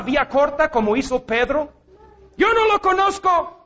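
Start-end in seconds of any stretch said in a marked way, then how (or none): 2.4-3.6 raised, nervous denial as Peter; Peter's denial. Acted. Preacher voicing Peter denying he knows Jesus, higher nervous denial. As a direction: brush off the question like a frightened Peter.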